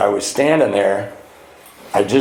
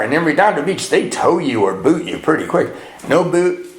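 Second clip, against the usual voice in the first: usual speaking voice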